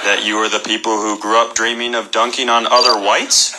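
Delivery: deadpan delivery. Acted. Deadpan comedian holds low drone.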